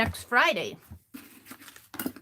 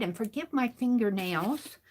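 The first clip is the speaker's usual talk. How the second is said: normal talking voice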